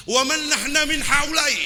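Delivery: rhetorical cry. Shown as a demonstration. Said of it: "repeated line, first take".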